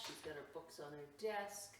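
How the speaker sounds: ordinary talk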